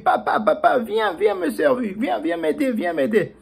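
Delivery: high, pleading cries for papa; a stuck little mouse begging help; desperate calling. Acted. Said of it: "était en train de dire".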